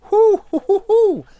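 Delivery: very high, loud "whoo-hoo"; loud high whooping. Real, not acted. Streamer amazed at twenty plus games, whooping. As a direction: whoop at the sheer scale of it.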